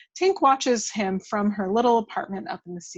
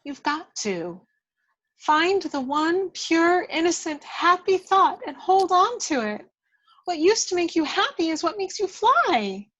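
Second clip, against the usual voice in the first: fairy Tink; 9 semitones higher than usual. Acted. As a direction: urge him gently from a higher voice.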